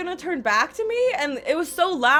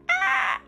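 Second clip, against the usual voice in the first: a short, very high yelp of nerves; nervous squeak. Retold or imitated. Nervous fan working up courage, high squeak. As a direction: squeak out a panicked little ah. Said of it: "until I was like".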